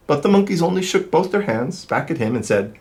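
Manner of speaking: lively, sing-song storybook reading; reading to children. A narrator's reading. Reader telling kids the peddler story, animated. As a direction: read it like to a class of little ones. Reads picture book aloud.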